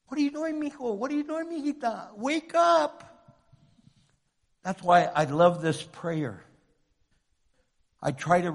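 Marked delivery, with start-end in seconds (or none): 0.1-3.0 put-on God pleading with his children, higher-pitched; God's wake-up call. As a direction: call gently from above, rising on the last call. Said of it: "He says".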